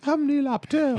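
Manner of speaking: squeaky high put-on intro voice; imitating his goofy self-introduction; funny high voice. Host mocks cohost's silly intro in a high voice. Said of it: "That's like the voice he did".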